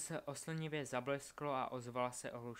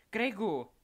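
high, very loud warning yell; yelling at him; 8 semitones higher, 11 dB louder than usual. A narrator's reading. Albus yells to Craig amid danger, urgent shout. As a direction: yell his name urgently. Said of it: "zařval na něj Albus".

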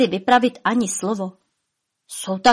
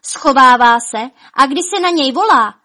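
urgent report; 5 semitones higher, 7 dB louder than usual. A narrator's reading. Dwarf reporting to elves.

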